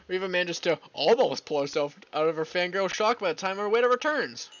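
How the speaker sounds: ordinary talk, nothing marked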